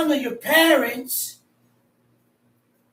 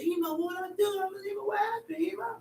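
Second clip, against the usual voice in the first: soft, higher, humble voice to Ema; lightly voiced grown son answering his mother meekly; 7 semitones higher, 10 dB quieter, much faster than usual. Grown son answering his mother, softly higher and humble.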